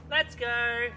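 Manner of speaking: lifted gentle "Let's go"; gentle high call. Shown as a demonstration. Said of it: "just gentle, like that".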